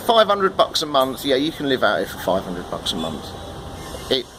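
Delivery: usual speaking voice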